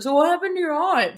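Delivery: high, loud comic-relief impersonation; Azriel. Retold or imitated. Comic relief asking while eating, playfully high-pitched. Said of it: "hear him eating and he's just like".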